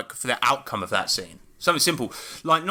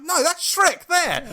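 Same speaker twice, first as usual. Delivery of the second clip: much higher, slow, faux-sweet; mock innocent teasing; 4 dB louder, slower than usual. Uncle needling his niece about a character, high slow faux-sweet. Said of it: "I'm like".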